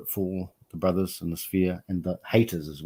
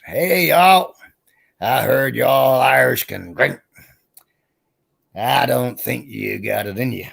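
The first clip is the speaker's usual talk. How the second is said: drawn-out Texan challenge, pitched up on the call; Texan accent; 3 semitones higher, 4 dB louder, slower than usual. Acted. Big man challenging an Irish pub, Texan accent.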